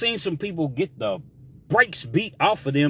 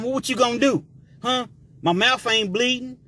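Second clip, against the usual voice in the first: higher, cocky prison taunt; mocking taunt; 7 semitones higher than usual. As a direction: egg him on, daring him.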